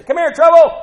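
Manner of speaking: loud, high call across the house; parent yelling. Retold or imitated. Parent hollering for a troublesome child, loud call. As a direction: holler for the kid to come.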